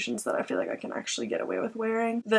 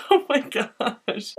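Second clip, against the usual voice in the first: laughing; 5 semitones higher, slower than usual. Real, not acted. Laughing at own goldfish joke.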